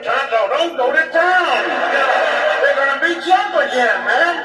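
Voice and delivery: hollering at the radio. Retold or imitated. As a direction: yell to warn Tonto through the radio. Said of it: "I'd always holler on the radio".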